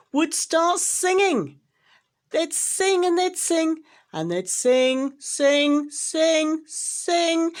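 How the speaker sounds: grating mockery of the Whos' singing; mocking singsong. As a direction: lilt it like a taunting song.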